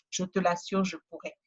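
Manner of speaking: ordinary talk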